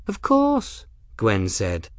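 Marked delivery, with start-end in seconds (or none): 0.1-0.8 very high, condescending confirmation; incredulous disdain; as to an idiot. A narrator's reading. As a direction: say it as if it's painfully obvious. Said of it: "Her tone suggested that the man was an idiot".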